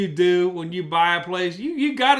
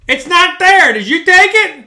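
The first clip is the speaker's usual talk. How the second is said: louder, higher call back to his wife; retold call to wife; 9 semitones higher, 9 dB louder than usual. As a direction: push back at her, voice raised. Arguing with wife over hammer.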